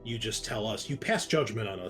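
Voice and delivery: ordinary talk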